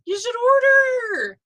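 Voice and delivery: high, loud, slowed push; excited urging. Real, not acted. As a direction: cheer them into ordering. Urging viewer to buy.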